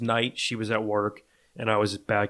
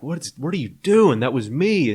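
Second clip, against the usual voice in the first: higher, louder baffled question; incredulous protest; 5 semitones higher, 5 dB louder than usual. Retold or imitated. Boyfriend to girlfriend who fled, incredulous retold protest. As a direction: protest in disbelief, pitch swooping up.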